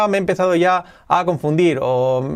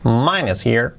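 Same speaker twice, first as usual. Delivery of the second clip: quick, linked words; brisk natural-speed model; said fast; 4 semitones lower, slower than usual. Shown as a demonstration. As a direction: run it together fluently. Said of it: "Dicho rápido".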